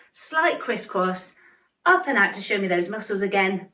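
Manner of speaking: ordinary delivery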